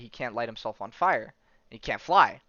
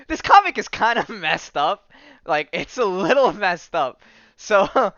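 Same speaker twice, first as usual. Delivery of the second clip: talking through laughs, higher and louder; laughing; 7 semitones higher, 10 dB louder than usual. Real, not acted. Commenting on a messed-up comic to viewers, laughing. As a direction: crack up while getting the words out.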